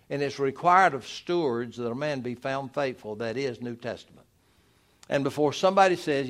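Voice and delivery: normal talking voice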